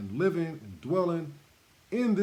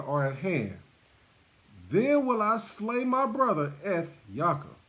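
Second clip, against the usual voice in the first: scripture reading; 3 semitones higher than usual. Acted. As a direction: read the Bible text with weight. Reads scripture aloud.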